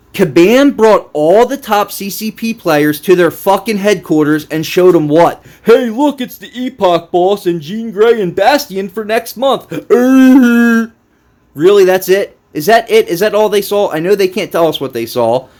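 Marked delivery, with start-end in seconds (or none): 0.1-5.4 loud, high exasperated rant; incredulous heated tirade. Real, not acted. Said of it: "I'm gonna go off in this video". 5.6-9.7 high mocking voice of a cheery presenter; sarcastic high-pitched mimicry; mock showcase. 9.7-13.9 loud, high exasperated rant; incredulous heated tirade. Real, not acted. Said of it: "I'm gonna go off in this video".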